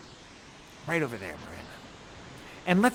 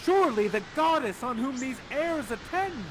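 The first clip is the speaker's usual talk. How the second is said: higher, hushed theatrical wonder; Ferdinand's awed cry; 5 semitones higher, 6 dB quieter than usual. Acted. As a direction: play an awestruck young lover. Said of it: "you cry out at Miranda".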